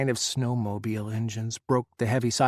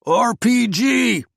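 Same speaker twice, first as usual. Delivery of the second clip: very loud, much higher, slow bellow; 10 semitones higher, 8 dB louder, much slower than usual. A narrator's reading. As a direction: roar each letter out. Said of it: "Ethan bellowed again".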